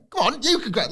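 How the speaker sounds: very high, giggly needling; laughing mock tease. Finn pushing Dom to congratulate her, giggly high tease. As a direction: imitate the prod through a laugh. S1 mimicking Finn's prodding.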